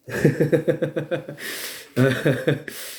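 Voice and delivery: real drawn-out cackle; long laugh. Real, not acted. Laughs at own grim story.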